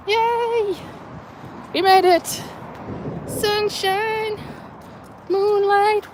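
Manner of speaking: high, bright calls to the animals; cheerfully greeting the pets by name; excited pet calling. Real, not acted. Speaker greeting her pets after the walk, excited high calls.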